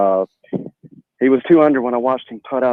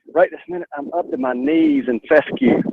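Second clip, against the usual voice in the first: hushed golf-course whisper; golf commentator; 11 semitones higher than usual. Real, not acted. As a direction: whisper it like you're beside the green. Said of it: "I've almost gotta talk in the golf voice".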